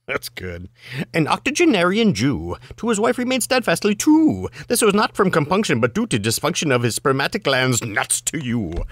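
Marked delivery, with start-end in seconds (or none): 1.1-8.9 fast patter without stopping for the rhymes; rapid-fire recitation. Acted. Reader rattling off the limerick a second time, rapid-fire. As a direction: race through it, starting high and falling.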